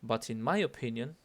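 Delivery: MY leaned on, opinion left rising; stress on MY. Shown as a demonstration. Teacher marking whose opinion it is, stress on MY. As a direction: punch the word my. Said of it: "de nuevo, my".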